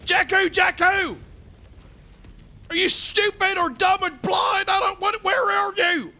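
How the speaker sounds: exasperated shouting into the comms; angry yell. Acted. Commander shouting at missing teammate, exasperated yell.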